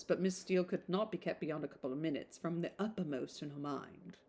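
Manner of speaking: plain reading voice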